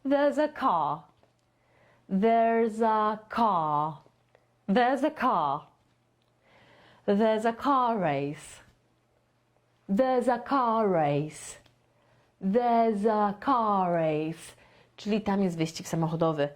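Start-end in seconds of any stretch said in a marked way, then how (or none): 0.1-5.7 very slow, "There's a car" slurred into one chain; linked there's a car. Shown as a demonstration. Teacher demonstrating words joined into one chunk, slow. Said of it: "Nie car, tylko car". 7.1-14.6 very slow, "There's a car race" flowing as one chain; linked there's a car race. Shown as a demonstration. Teacher modelling the joined phrase slowly for students. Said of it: "zlepkę w wymowie".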